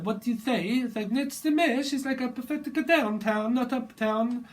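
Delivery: high, loud sassy diva voice; sassy queen saying she prefers downtown. Retold or imitated. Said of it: "sassy, sassy, sassy queen".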